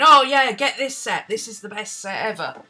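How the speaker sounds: mock-enthusiastic gush, starting very loud; gushing recommendation. People telling others to get this set, mock gushing. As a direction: imitate overexcited fans, loud burst easing off.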